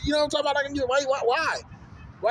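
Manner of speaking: high, repetitive fed-up questioning; exasperated. Real, not acted. Man venting about being bossed around at gym, exasperated.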